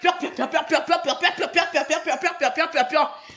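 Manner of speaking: rapid, repetitive pecking noises; mocking staccato chicken pecks; mimicked hens pecking. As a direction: imitate a flock of hens at the grain. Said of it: "Vous êtes tous en train de picoter".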